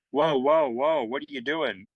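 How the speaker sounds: high, startled editors' whoa; mock alarm. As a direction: throw up your hands in mock panic. Editors alarmed, high quoted exclamation.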